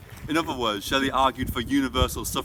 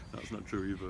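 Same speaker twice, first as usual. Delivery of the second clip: low, very soft mutter to oneself; self-correcting aside; 5 semitones lower, 12 dB quieter than usual. Real, not acted. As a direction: catch your own mistake under your breath. Muttered self-correction between takes.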